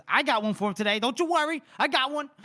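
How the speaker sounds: loud, high-pitched comic outburst; hammed-up boast. Podcaster voicing a character's promise of a surprise, hammed-up boast.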